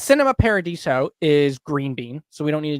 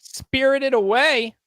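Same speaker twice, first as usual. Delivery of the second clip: drawn-out announcer call; 10 semitones higher, 3 dB louder, slower than usual. Acted. Announcing next movie.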